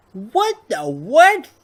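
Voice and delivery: high, loud, drawn-out disbelief; astonished. Real, not acted. Player reacting to Officer Blunt visiting Grandpa, loudly astonished. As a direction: stretch each word in baffled surprise.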